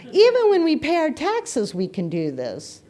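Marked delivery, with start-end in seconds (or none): 0.0-1.7 laughing. Real, not acted. Jokes about paying taxes.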